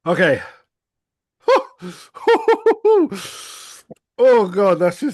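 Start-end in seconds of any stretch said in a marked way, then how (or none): 2.2-3.5 high burst of laughter; laughing through the burn; pained laugh. Real, not acted. Reacting to the heat.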